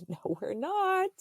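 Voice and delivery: high, drawn-out mock refusal; retold protest. Wife refusing husband's camping plan, high-pitched protest. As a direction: push it up high and drag it out.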